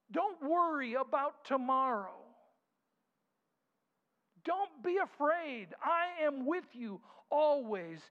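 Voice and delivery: slow ringing promise, full voice; booming voice of God. Acted. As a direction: declare it over the crowd like God speaking. Preaching God's voice at full force.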